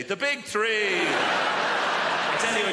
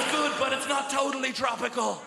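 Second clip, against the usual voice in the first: 1 wine-taster verdict; 6 semitones higher than usual. Pretend. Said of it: "and you're like".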